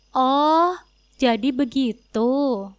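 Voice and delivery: slow, drawn-out knowing "oh"; sarcastic realisation. Acted. Wife coolly mocking husband's excuse, slow sarcastic drawl. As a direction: let it dawn on her, coolly mocking.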